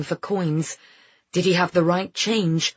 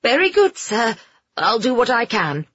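high, flustered operator; startled high-pitched compliance; shaken; 6 semitones higher, 4 dB louder than usual. A narrator's reading. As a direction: answer meekly, taken aback. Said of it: "The woman sounded shaken".

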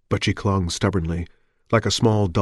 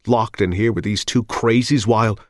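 plain reading voice

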